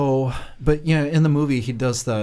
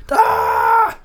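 a sudden loud yell of "ah"; shout; 8 semitones lower, 7 dB louder than usual. Real, not acted. Host yelling on hearing the word dick. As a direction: bark a quick loud "ah". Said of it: "you have to yell, "Ah!".

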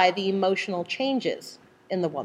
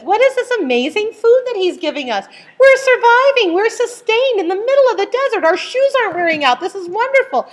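high, louder exclamations of wonder; amazed gratitude; 12 semitones higher, 5 dB louder than usual. Acted. God-focused people delighting in the manna, excited wonder. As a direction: marvel aloud, pitched high. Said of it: "people who had their mind focused on God".

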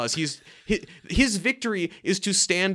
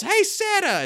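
high-pitched, louder shout; retold yell, pitched way up; imitated yell; 5 dB louder than usual. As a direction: call out as if to someone up on a roof. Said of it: "just yells".

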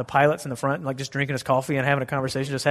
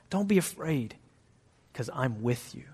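softer, lower tender comfort; comforting reassurance; 3 semitones lower, 8 dB quieter than usual. Shown as a demonstration. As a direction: soothe them gently. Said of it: "You know what he always says?".

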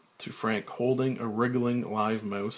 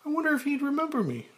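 high, fast woman's voice; Claire; much faster than usual. Acted. Claire noticing Frank's change, in a high voice.

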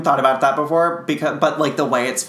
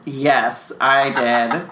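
ordinary delivery

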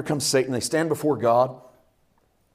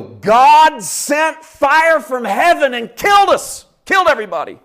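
higher, loud, drawn-out cry of disaster; servants' alarmed report; 9 semitones higher, 7 dB louder than usual. Acted. Servants reporting disaster to Job, loud and alarmed. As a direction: cry the news out loud and high. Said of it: "when they get to Job, they go".

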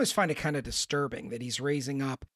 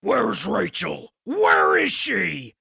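slow, loud Batman yell, climbing higher; mock Batman roar; Batman growl; 6 semitones higher, 8 dB louder, much slower than usual. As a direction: roar it like the Dark Knight. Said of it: "but then he does the".

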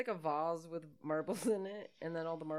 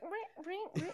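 mock wrench sound; 13 semitones higher, slower than usual. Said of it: "She's like".

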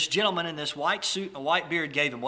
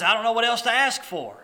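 higher, louder mock exasperation; retold outburst, pitched up and loud; upset; 7 semitones higher, 7 dB louder than usual. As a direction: act out an exasperated complaint. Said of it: "the guy gets upset".

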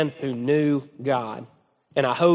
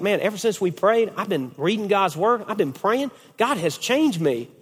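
higher, swooping youthful testimony; lilting, higher retold testimony; imitated elated teen; 4 semitones higher than usual. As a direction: imitate a kid marvelling at himself. Voices the transformed teen's testimony.

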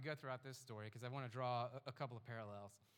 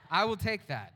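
excited; 9 semitones higher, 9 dB louder, slower than usual. Retold or imitated. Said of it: "I'm like".

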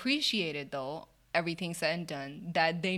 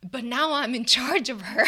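ordinary talk, nothing marked

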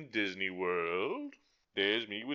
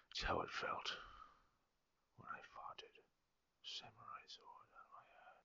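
whisper; 16 dB quieter than usual. Real, not acted. Hushed fart story.

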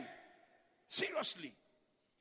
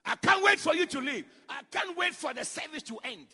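louder, fed-up mock outburst; exasperated outburst; 5 semitones higher, 10 dB louder than usual. Preacher mimics a churchgoer desperate for the service to end, loud and exasperated. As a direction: burst out like someone desperate to leave.

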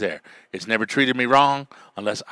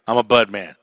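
ordinary talk

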